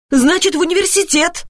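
high-pitched, impatient retort; irritated mother correcting her son. A narrator's reading. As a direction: insist crossly, pitch jumping up. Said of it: "раздражённо проговорила Алёна".

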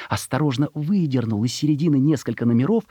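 usual speaking voice

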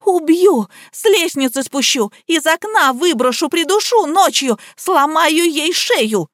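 high-pitched murderous rant; crying out threats. A narrator's reading. Liza threatens to kill Nika, crying out. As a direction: shout each threat up high. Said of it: "выкрикивала Лизавета".

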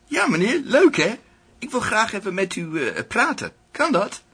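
high, sugary, overfriendly official voice; friendly and warm. Acted. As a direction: smile it sweetly at him. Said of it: "met een vriendelijk en warm glimlach". (vriendelijk en warm, hoog en zoetsappig)

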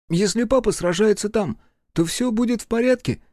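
put-on high, hopeful little boy; child voice. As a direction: ask like a worried small boy. Child asks mother.

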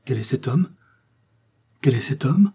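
breathless, terrified whisper; choked with terror. Acted. Milady sees the man in the red cloak, breathless with fear. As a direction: gasp the question in horror. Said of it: "suffoquée par la terreur".

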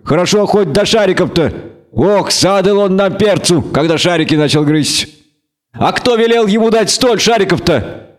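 a hint of a folksy village man; villager voice.

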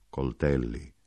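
basso e morbido; voce dolce, profonda. A narrator's reading. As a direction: ripeti dolcemente, in tono grave. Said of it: "con voce dolce, profonda". (dolce e profondo)

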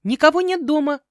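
high, loud yell; shouted denial. Acted. Heroine to the unwanted visitor through the door, shouting. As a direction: holler it at the door. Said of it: "крикнула".

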